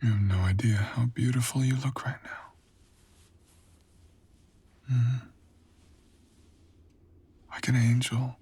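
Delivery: hushed, savouring whisper; admiring whisper. Acted. Seducer admiring the listener lying on the altar, whispered. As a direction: breathe the compliment in close.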